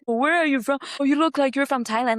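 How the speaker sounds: mock heckler. Imitating the heckler taunting her after the open mic. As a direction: needle her like a pushy heckler. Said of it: "Then he just kept".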